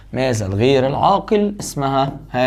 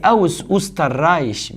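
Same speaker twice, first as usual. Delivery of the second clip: slow dictation; 2 semitones higher, much slower than usual. Shown as a demonstration. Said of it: "slow dictation of the answer".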